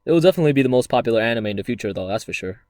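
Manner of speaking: fast confident boast. Acted. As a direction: rattle it off with bravado. Skit character boasting.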